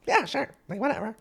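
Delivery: high mock child; squeaky careless kid; mocking the kids at the beach as bratty. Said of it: "they're children".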